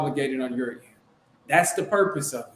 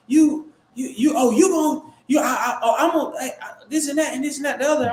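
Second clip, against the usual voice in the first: mock angry tirade; 7 semitones higher than usual. Mimicking the seller cussing at the agent, sputtering high. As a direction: ham up her cussing fit, stammering and high. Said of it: "she's, like, cussing at me".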